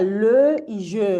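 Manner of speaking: "Le" and "je" drawn out slow with rounded lips; exaggerated closed vowels. Shown as a demonstration. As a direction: stretch "Le" and "je" through a pursed mouth. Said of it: "queda como cerradita la boca". («Le» y «je» lentos y exagerados, boca cerradita)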